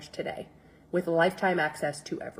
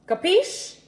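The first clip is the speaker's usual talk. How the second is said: 1 playful capiche; 11 semitones higher than usual. Pretend. Playful check viewers understood.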